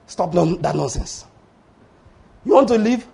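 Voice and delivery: ordinary delivery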